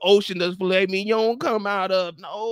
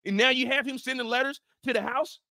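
ordinary delivery

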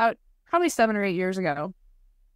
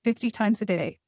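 ordinary talk

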